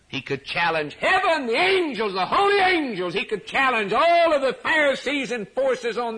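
high shouted pulpit challenge, climbing in force; full-force preaching. Real, not acted. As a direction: build to a loud, defiant dare.

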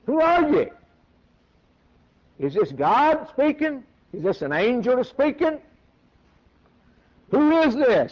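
high, loud astonished asking; bewildered questions. Acted. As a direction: demand to know who speaks. Saul bewildered by voice.

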